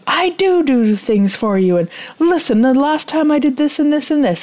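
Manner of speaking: rising, pushed-up mock defensive pitch; getting worked up while listing what you did; defensive reply. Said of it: "So by you saying".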